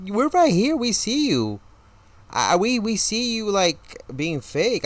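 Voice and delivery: high, loud mock indignation; indignant calling out. Retelling indignant reaction to waitress.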